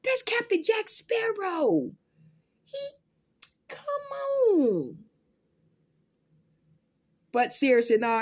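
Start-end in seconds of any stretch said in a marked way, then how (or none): 0.0-5.0 mock indignant whine. Scolding Amber for Johnny.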